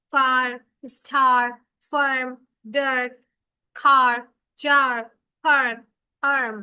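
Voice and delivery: ফার, স্টার, খার called out loud and clear, one by one; r-controlled demo words. Shown as a demonstration. Teacher calling out the example words to the class, loud. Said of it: "তাহলে উচ্চারণ কেমন হবে?".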